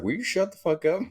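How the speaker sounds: put-on shrill-high fed-up girl; high mocking girl voice. Brenda telling the rapper to shut up, high mock voice. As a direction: snap at him in a high girly voice.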